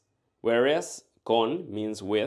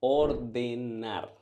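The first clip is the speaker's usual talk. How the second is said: slow model answer; slower than usual. Shown as a demonstration. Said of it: "Here's how to say "to order".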